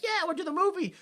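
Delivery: high, put-on chirpy voice; mock eager agreement. Box-seat onlookers agreeing to the movie, mock eager. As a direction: pipe it up as a silly quote.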